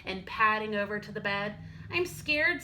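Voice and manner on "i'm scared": higher small cub voice; a tiny scared cub, pitched up; little bear cub. Acted. Little Bear tells Big Bear he is scared, high small voice.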